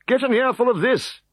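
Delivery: high, happy bard voice. Acted. Cacophonix to the Decurion, high and happy. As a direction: relish his coming song. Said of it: "the happy Cacophonix".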